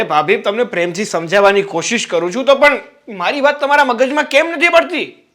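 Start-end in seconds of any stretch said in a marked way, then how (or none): 3.6-5.3 frustrated outburst. Acted. Heated argument with sister-in-law.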